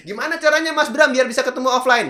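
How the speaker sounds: high, loud mock audience question; imitated eager fan. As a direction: call out as an eager follower. Menirukan penonton bertanya. (menirukan penonton bertanya dengan nada tinggi)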